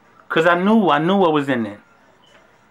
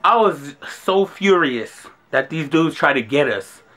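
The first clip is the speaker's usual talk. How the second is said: normal talking voice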